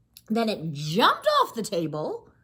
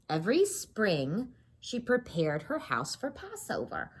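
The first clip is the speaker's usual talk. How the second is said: ordinary delivery, nothing marked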